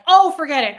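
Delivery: re-enacted flash of temper; fit of annoyance. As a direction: snap out a fed-up retort. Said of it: "I jump into a fit of rage".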